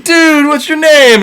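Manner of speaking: put-on high, slow, drunken giant question; hammed-up high cyclops asking a name. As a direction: stretch it out, high and tipsy. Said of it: "and he's like".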